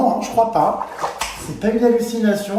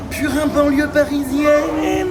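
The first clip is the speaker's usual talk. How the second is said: teasing; 7 semitones higher, 4 dB louder, slower than usual. Real, not acted. Teasing nickname for friend.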